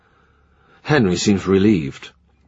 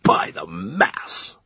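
slow, softer, lower mutter; muttered oath; 3 semitones lower, 5 dB quieter, much slower than usual. A narrator's reading. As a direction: grumble it under his breath. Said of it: "mutters the Duke".

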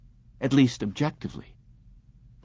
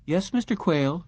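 higher, polite receptionist voice; receptionist on the phone; 7 semitones higher than usual. A narrator's reading. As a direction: announce the visitor sweetly.

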